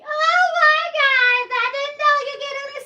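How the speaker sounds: high, loud, sing-song mockery; mock sweet sarcasm. She mocks someone asking for free makeup, high and sing-song. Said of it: "I be".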